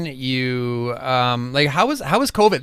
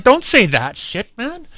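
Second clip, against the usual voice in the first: mock protest; 7 semitones higher, slower than usual. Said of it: "and I'm like".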